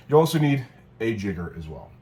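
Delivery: ordinary talk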